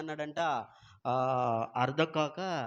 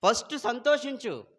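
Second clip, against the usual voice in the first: higher, louder proclaimed command; emphatic exhortation; 7 semitones higher, 4 dB louder than usual. Shown as a demonstration. Preacher commands husbands to rejoice in their wives, loud and emphatic. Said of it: "ఏం చేయాలంట?".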